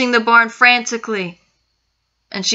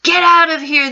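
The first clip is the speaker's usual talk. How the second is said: higher, loud angry order; uncle yells; 7 semitones higher, 5 dB louder than usual. Acted. Uncle orders girl out of the room, yelling. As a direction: throw her out with a yell. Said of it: "the uncle yells".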